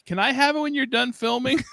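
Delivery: a younger sibling pipes up, high and hopeful; little kid voice. Retold or imitated. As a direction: ask sweetly like a little kid. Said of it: "my youngest was like".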